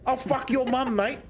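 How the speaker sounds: high, mocking challenge to a fight; cocky kangaroo voice. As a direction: taunt like a cocky Aussie bloke picking a fight. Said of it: "They're going".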